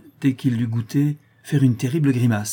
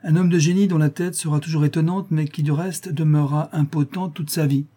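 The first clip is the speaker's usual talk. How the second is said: exclaiming; 6 semitones higher than usual. A narrator's reading. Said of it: "s'écria la tante".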